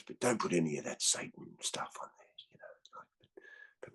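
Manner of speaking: hushed, conspiratorial whisper; whispered request. Retold or imitated. Mick asking for tapes without Satan stuff, whispered. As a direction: ask it like a guilty secret.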